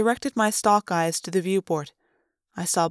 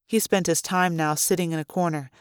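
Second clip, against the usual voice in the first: usual speaking voice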